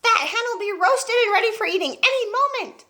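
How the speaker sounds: high, lightly voiced crone shouting; gloating old woman. Grandma jeers down at the burning hen, lightly voiced. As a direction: jeer down from the roof. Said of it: "Grandma shouted".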